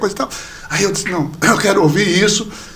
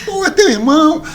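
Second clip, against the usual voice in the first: much higher-pitched mock astonishment; retold surprise; 9 semitones higher, 4 dB louder than usual. Friend learns they are brothers, mock surprised and high.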